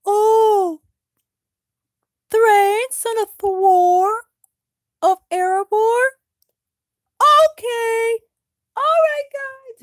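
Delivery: high, loud clerk voice; orc receptionist. Acted. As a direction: read the name out brightly. Orc receptionist reads form.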